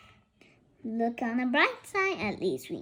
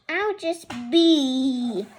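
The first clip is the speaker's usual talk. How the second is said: ordinary delivery, nothing marked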